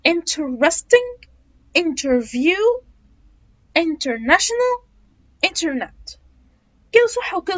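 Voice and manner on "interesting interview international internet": "interesting" said slowly with a clear t; t pronounced. Shown as a demonstration. Teacher showing the careful version with t. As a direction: spell out the t in "interview", slow and careful. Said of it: "لأ، ال T بتتنطق".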